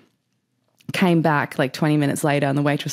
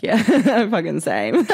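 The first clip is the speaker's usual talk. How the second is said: amused, higher-pitched, laugh breaking in; laughing agreement; 10 semitones higher than usual. Real, not acted. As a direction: agree while cracking up.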